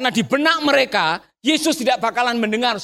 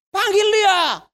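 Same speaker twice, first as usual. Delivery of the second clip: high, emphatic order; commanding call; 8 semitones higher, much slower than usual. Acted. Jesus' order called out.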